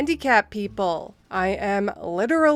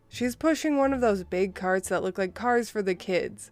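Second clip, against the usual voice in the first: plain reading voice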